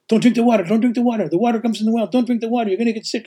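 high, insistent warning from a worried host; repeated anxious caution from a friend; urgent warning. Retold or imitated. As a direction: plead with them not to drink it. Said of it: "And Liliana's like".

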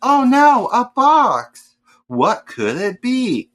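high, loud hammed-up gasp of shock; mock surprise. Mocking Devin opening nested boxes, high loud hammed-up shock. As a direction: ham up fake amazement at a box. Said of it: "I was like".

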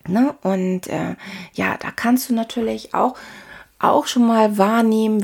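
ordinary delivery

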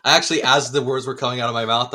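ordinary talk

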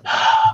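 deep breath. Retold or imitated. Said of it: "So I took a deep breath".

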